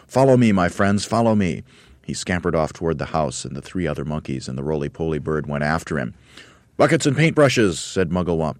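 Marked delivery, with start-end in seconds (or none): 0.1-1.6 lifted, louder call to follow; rally the troops, a touch louder. A narrator's reading. Monkey leader to his friends, rallying call. 6.8-7.8 higher, louder eager announcement; eager orders. A narrator's reading. Monkey leader to his helpers, eager orders.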